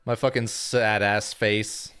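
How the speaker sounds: ordinary delivery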